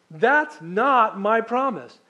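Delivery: slow, loud stress on "not my promise"; emphatic God. Acted. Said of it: "You can almost hear God going".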